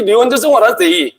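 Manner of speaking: very slow English with a light Indian lilt, stress on what; mock Indian accent. Preacher repeating his Indian caricature for the crowd, mock Indian accent. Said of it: "This is Indian people, they talk this, this way".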